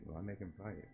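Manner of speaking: usual speaking voice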